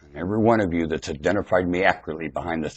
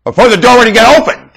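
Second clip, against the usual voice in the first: higher and louder, the line proclaimed; loud preaching take; 18 dB louder than usual. Shown as a demonstration. Preacher restarting his story for the congregation, proclaiming it loud. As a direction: call it out to the whole room. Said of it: "I'll start where I started".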